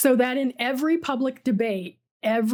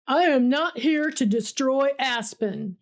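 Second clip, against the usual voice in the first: usual speaking voice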